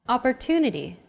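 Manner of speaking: "Opportunity" with "tu" stressed and held longer; stress on third syllable. Shown as a demonstration. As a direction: lean on the "tu" in "Opportunity". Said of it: "it's pronounced longer than all other syllables".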